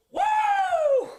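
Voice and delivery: very high, loud whoop with a laugh; loud woo. Real, not acted. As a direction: whoop it out mid-workout. Cheer during workout.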